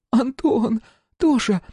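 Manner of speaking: high anxious calling of a name; worried calling out. A narrator's reading. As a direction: call him, frightened. Woman calls to fallen man.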